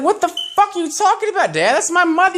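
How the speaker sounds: high pitched outraged yelp; high shocked protest at dad; mock outrage. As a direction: squeal it in disbelief at your dad.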